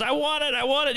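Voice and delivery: mock a whining kid who wants cereal. Parent imitating a kid begging for cereal, mock whine. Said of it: "And the kid goes".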